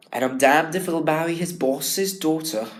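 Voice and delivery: "damned" in a posh English accent, the wrong take; a posh English accent, how Billy should not sound; posh English delivery. Shown as a demonstration. Student showing the wrong posh English Billy line. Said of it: "otherwise it would sound really posh".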